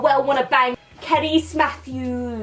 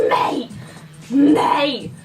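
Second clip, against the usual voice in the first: ordinary talk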